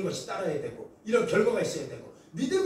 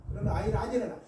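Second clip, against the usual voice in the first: ordinary delivery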